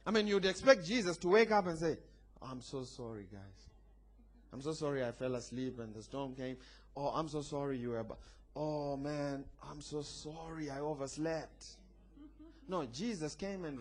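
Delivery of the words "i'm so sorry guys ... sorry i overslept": soft, low, hammed-up apologising; mock meek apology. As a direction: apologise sheepishly, as if imitating someone meek. Imagines Jesus meekly apologising to disciples.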